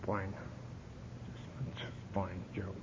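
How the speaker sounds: sorry attitude. Retold or imitated. Newcomer answering Gerald's greeting, sullen, soft and slow. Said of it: "sorry, uh, sorry attitude".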